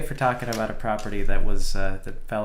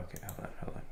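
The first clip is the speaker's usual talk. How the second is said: whispering; 17 dB quieter, much faster than usual. Real, not acted. Muttering to self.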